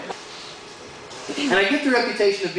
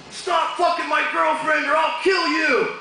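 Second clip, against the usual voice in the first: slow, high furious phone threat; very angry; 8 semitones higher, slower than usual. Retold or imitated. Angry boyfriend on the phone threatening the cheater, shouting. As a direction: yell a death threat down the phone. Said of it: "very angry".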